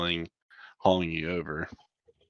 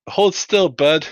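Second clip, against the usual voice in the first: higher, louder, friendly check-up voice; medic crewman; 6 semitones higher, 4 dB louder than usual. Acted. Crewman scans the pulled-in pilot, higher friendly medic voice. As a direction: patter like a casual field medic. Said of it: "and just like".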